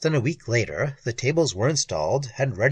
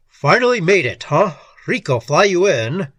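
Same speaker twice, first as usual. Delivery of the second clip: loud, higher booming boss; 5 semitones higher, 7 dB louder than usual. A narrator's reading. Gangster boss greets a new arrival, booming voice. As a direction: boom it out like a big loud boss. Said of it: "voice that could kill horseflies at five hundred feet".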